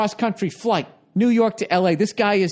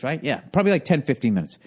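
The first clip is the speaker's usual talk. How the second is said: ordinary talk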